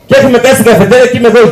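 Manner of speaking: usual speaking voice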